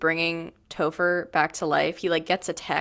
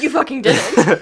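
imitating Laszlo; 4 semitones higher, 8 dB louder, slower than usual. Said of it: "and then Laszlo goes".

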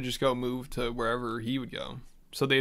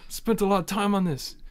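put-on Russian accent; 7 semitones higher than usual. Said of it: "That sounded like a Russian accent".

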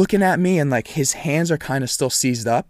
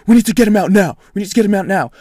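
imitated urgent shout, high and loud; retold frantic yelling; panicking bystander; 6 semitones higher, 7 dB louder, faster than usual. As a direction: raise the voice high, acting frantic.

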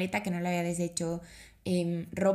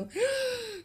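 nervous shriek. Retold or imitated. Frozen with nerves before opening.

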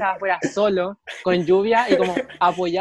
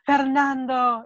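retold drunken cry; 7 semitones higher, much slower than usual. Nico interrupting from the floor, high mock yell. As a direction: imitate a drunk friend calling out high. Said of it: "el Nico grita".

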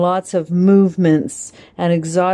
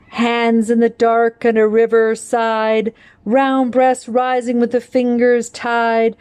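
higher, lilting poetry reading; poem recitation; 6 semitones higher than usual. Acted. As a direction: perform the verse for the class, savouring each rhyme. Recites poem after title.

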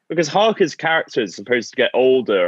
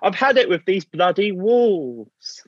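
a hint of a posh old man, higher and slow; lightly voiced posh old man; 8 semitones higher, slower than usual. Mocking Keanu's posh dinner voice for friends. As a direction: tease the film's posh old man line, slowly. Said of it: "posh old man voice".

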